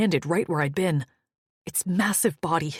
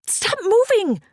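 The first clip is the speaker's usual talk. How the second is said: high, loud frantic yell; 6 dB louder, slower than usual. A narrator's reading. Fleck yelling at Maddock to stop moving. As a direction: yell it in panic. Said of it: "I yelled".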